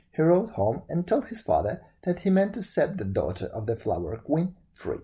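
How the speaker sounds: usual speaking voice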